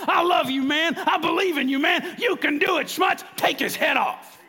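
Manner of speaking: loud, high-pitched shout of encouragement; Coach Wade yelling. Retold or imitated. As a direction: yell belief into your player from the sideline. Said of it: "when I heard Coach Wade yelling at me".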